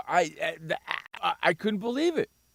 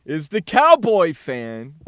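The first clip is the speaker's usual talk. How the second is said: ordinary delivery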